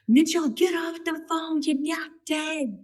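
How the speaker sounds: high, loud mock Jamaican accent; drawn-out, high-pitched Jamaican sergeant impression; Jamaican drill sergeant. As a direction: order a recruit around in an imitated Jamaican accent. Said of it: "she's got this Jamaican accent".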